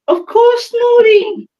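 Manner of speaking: high, teasing sing-song. Real, not acted. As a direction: tease her playfully. Teasing friend.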